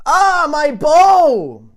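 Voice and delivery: high, very loud, slow wail; pained cry. Acted. Lady wailing about her broken elbow on the sidewalk. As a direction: howl out in pain.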